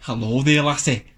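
light put-on Scottish; lightly voiced Scottish greeting; Scottish accent. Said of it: "speak to her in Scottish".

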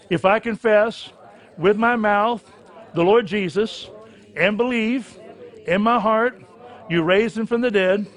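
loud, fervent prayer, line by line; rising fervent preacher's prayer, building to near-shouting; led prayer. Acted. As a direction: call the prayer out for the room to repeat. Leads congregation in sinner's prayer.

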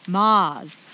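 "Mars" said loudly with the R dropped; Maine pronunciation. Shown as a demonstration. Host showing off how she said "Mars", Maine accent. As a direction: say "Mars" the Maine way, as a long "Mahz". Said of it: "See how I did it?".

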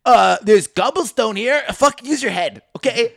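high, loud, impatient dad; mock exasperation. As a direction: scold them for missing the obvious. Dad scolds for dumb question.

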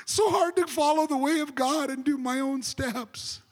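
high, long-drawn mock moan; hammed-up self-pitying complaint; mock whining. Mimics complainers again.

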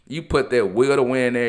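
ordinary delivery

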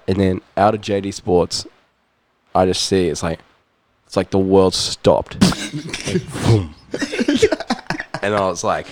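ordinary talk

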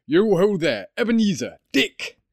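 comfortable, oily, jovial voice; jovial calling out. A narrator's reading. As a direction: call out heartily to the apprentices, pitch climbing. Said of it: "called out in a comfortable, oily, rich, fat, jovial voice".

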